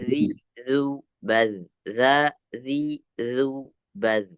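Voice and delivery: "zi, zu, baz" with a light, thin z; separate, carefully held demo syllables "zi, zu, baz"; thinned consonant drill. Shown as a demonstration. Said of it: "Ditipiskan lagi".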